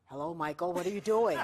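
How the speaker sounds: deep put-on phone voice; disguised caller voice. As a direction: drop low and pose as the caller. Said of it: "Does she sound like".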